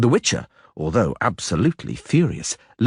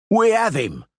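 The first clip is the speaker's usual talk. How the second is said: shouted; 11 semitones higher than usual. A narrator's reading. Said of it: "the masons shouted".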